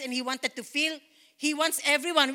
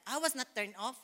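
usual speaking voice

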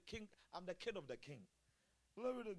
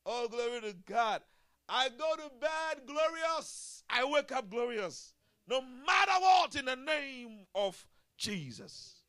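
shouted, much higher praise, stretched slow; full-force preaching; 9 semitones higher, 15 dB louder, slower than usual. Acted. Preacher rallies the church to glory, shouting praise. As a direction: lift the whole room with a triumphant cry.

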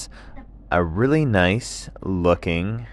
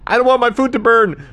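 very high, fast yell; frantic high-pitched cartoon shout; dingo shouting back; 10 dB louder, faster than usual. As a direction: holler back over distance on the run. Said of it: "the dingo would shout back".

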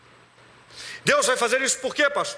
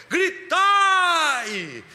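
high, drawn-out battle cry; long rallying yell, falling at the end; Joshua's shout; 9 semitones higher, 4 dB louder than usual. Retold or imitated. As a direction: shout the command to the whole army. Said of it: "Josué dá um grito".

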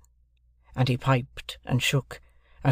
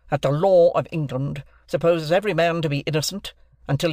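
raised, pressing courtroom voice; lawyer's interrogation; 3 semitones higher, 3 dB louder than usual. Acted. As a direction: grill them like a barrister. Stranger cross-examines the group.

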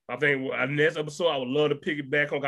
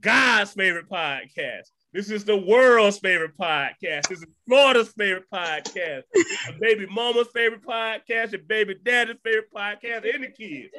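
podcast hype sign-off; 5 semitones higher, 4 dB louder than usual. Acted. Host hyping the show to listeners at sign-off, announcer style. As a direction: proclaim each title like a hype man.

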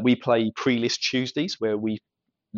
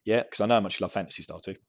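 normal talking voice

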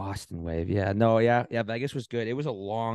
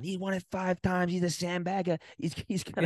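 yelling; 10 semitones higher than usual. Retold or imitated. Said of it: "So I'm yelling".

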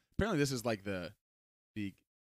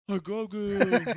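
slow, higher imitation of a toddler saying Grogu; 6 semitones higher, 4 dB louder, much slower than usual. Dad imitating his toddler son, slow and higher. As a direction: mimic a little boy begging for Grogu. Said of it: "he finishes it and he goes".

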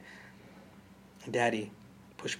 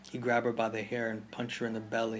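plain reading voice

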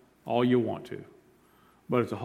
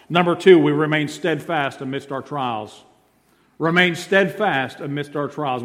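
normal talking voice

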